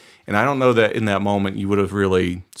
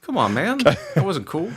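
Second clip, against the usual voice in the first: offended bodyguard; 8 semitones higher than usual. Acted. Bodyguard complains, acted.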